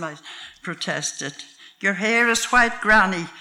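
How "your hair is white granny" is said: put-on child's loud call, higher; shouted. A narrator's reading. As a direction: shout it out like an excited kid. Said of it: "my youngest grandson shouted".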